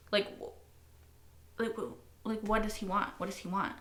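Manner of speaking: soft, almost whispered wondering; muttered puzzlement. Real, not acted. Puzzled viewer muttering to herself about the villain. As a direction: mutter it to yourself.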